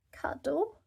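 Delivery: put-on high, hushed little-girl whisper; whispered high piglet voice; whispered toddler question. A narrator's reading. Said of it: "she said".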